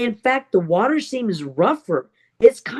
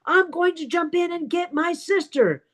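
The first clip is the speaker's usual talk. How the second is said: storyteller's high, held-back yell; retold yell; 7 semitones higher than usual. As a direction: act out a desperate declaration. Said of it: "my best friend yells".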